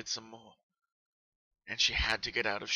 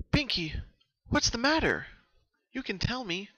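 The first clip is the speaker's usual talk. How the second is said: high, louder caring question; concerned coaxing; 10 semitones higher, 4 dB louder than usual. Acted. Twilight sitting beside downcast Pinkie, high caring voice. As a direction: coax her to open up.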